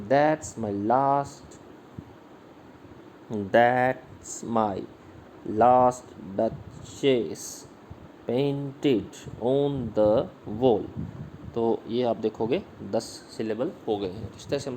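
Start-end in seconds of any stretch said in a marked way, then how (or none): 0.1-10.8 stretched syllables, with "my", "last", "duch" and "wall" stressed; very slow scansion. Shown as a demonstration. Teacher scans a Browning line very slowly. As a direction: drag each beat out deliberately. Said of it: "इस तरह से बोलेंगे".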